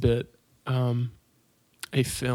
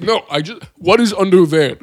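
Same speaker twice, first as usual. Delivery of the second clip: panicked; 5 semitones higher, 9 dB louder than usual. Retold or imitated. Panicking hostage quoted.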